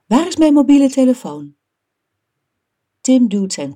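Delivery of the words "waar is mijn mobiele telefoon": loud, high, fast demand; calling out. A narrator's reading. Mum shouts from downstairs for her mobile phone, calling out. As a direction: call it quickly through the house. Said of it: "roept mama beneden".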